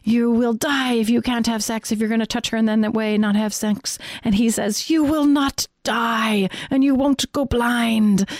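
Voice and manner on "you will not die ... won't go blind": imitating a thick foreign accent, slow and higher; Mark Gungor's accent. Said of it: "with his big, thick accent".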